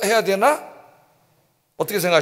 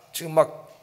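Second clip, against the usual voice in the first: ordinary talk, nothing marked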